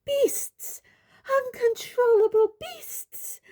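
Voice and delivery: very high, hysterical screech; screamed insult. A narrator's reading. Umbridge covering her head, very high hysterical scream. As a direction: shriek it, stretching the words. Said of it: "she screamed".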